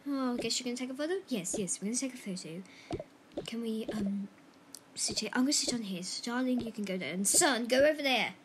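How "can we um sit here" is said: whispering. Pretend. Hushed question.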